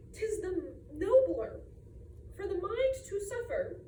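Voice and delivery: fussy aunt's swooping, stop-start oration; Aunt Emmy. Acted. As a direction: declaim it grandly, stopping to clear your throat. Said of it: "My Aunt Emmy ... would have me".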